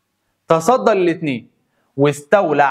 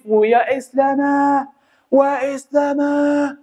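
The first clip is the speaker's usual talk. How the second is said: long, drawn-out higher war cry; battle cry; 9 semitones higher, much slower than usual. Acted. Qutuz rallying his soldiers, slow drawn-out battle cry. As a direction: stretch it out like a commander rallying troops. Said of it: "دي كانت صيحة سيف الدين".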